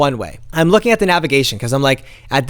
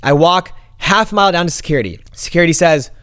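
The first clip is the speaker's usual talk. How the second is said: normal talking voice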